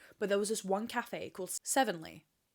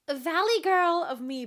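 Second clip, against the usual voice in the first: put-on valley girl; 9 semitones higher, 9 dB louder, slower than usual. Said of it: "that sounds like".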